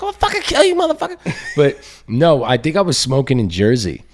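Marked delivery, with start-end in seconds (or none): 0.0-1.2 very high screechy threat; mock furious mom. Child imitating mom after hanging up, mock fury. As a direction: switch to a squealed, hammed-up rage. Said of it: "she'd, like, hang up the phone".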